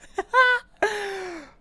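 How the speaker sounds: high, loud burst of laughter; delighted laugh. Real, not acted. Gamer overjoyed at first pull, delighted laugh.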